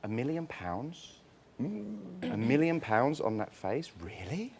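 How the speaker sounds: slow, soft, disbelieving rising questions; incredulous scoff. Real, not acted. Panelist scoffing at a housemate's million-pound face, slow and incredulous. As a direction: drag it out in mock disbelief. Mocking a contestant's pricey looks.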